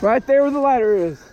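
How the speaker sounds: shouting. Real, not acted. Calling out at burning house.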